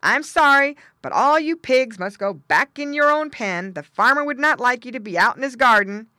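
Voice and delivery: firm guard dog. Acted. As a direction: send the pigs home firmly. Guard dog figure orders pigs.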